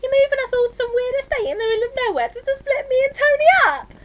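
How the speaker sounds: high, loud, anguished wail. A narrator's reading. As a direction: wail it out on the verge of tears. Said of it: "Martine wailed".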